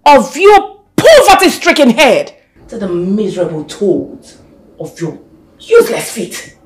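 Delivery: loud, venomous threat; madam threatening to bathe the maid in acid. Acted. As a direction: spit out the threat.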